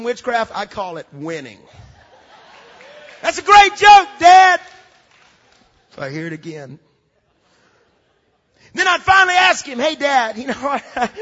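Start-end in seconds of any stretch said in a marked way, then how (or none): 3.2-4.6 loud, high put-on delight; mock hearty shout. Kid buttering up dad with loud fake delight. 8.7-11.1 eager buttering up. Retold or imitated. Kid sweet-talking dad.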